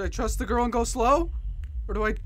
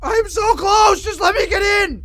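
frantic, near-crying yell; desperate wailing shout; 6 semitones higher, 8 dB louder than usual. Real, not acted. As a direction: wail in panic, begging to get through. Panicked plea near the goal.

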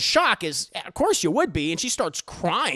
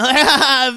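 loud mocking laugh; hammed-up laughing at someone; imitated group laughter; 7 semitones higher, 14 dB louder than usual. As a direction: burst out in put-on jeering laughter. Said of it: "they all start laughing".

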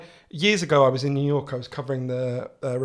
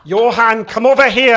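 high, loud Scottish mum calling; imitating a Scottish mother summoning her child; strong Scottish accent; 7 semitones higher, 14 dB louder than usual. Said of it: "strong Scottish accent".

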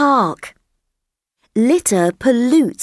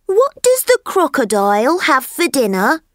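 higher, eager little elephant voice; curious elephant child; 6 semitones higher than usual. Acted. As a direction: ask like a wide-eyed young elephant. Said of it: "he asked".

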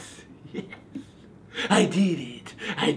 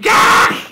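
angry yell; 8 dB louder than usual. Acted. Raging at pumpkin carving tools.

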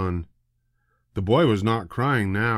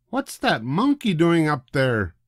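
higher woman's voice, demanding; 6 semitones higher than usual. A narrator's reading. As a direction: demand it, annoyed. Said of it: "demanded a woman".

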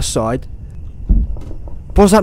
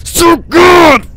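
much higher, loud triumphant shout; gloating shout; 13 semitones higher, 7 dB louder than usual. Pretend. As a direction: crow about your own skill. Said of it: "I'm too good".

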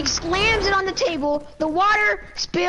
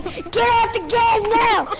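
high, slow, emphatic mock order; stretched angry-parent imitation; imitated yelling mom; 7 semitones higher, 4 dB louder, slower than usual. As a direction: mimic a fed-up mother shouting. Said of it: "then she's like".

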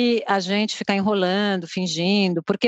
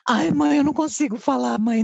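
higher-pitched mock-dramatic whine of a son; dramatic child; 4 semitones higher than usual. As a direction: imitate a kid making a drama of it. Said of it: "Daí ele fez um drama". (criança fazendo drama)

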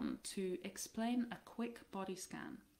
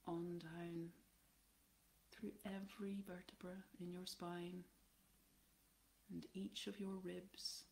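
guided body scan; 5 dB quieter than usual. Acted. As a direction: guide them gently down through the body, unhurried and even.

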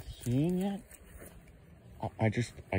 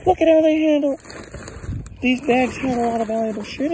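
outraged exclamation; 9 semitones higher, 5 dB louder than usual. Real, not acted. Outraged at movers' damage.

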